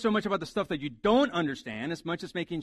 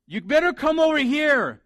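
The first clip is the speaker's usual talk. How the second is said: raised, high-pitched parental order; scolding parent; 9 semitones higher, 9 dB louder than usual. Acted. Parent ordering the kids over, loud stern warning. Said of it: "when you tell your, your kids".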